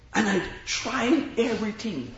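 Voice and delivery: ordinary delivery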